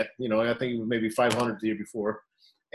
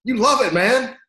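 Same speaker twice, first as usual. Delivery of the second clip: coach yelling; 11 semitones higher, 5 dB louder than usual. Retold or imitated. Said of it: "And I go".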